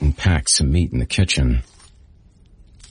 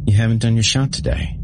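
put-on childish voice; 8 semitones higher than usual. A narrator's reading. Child speaks in baby voice.

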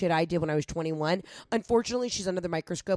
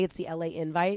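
ordinary delivery

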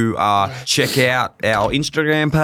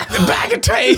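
shouted punchline. Pretend.

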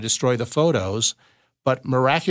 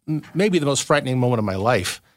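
normal talking voice

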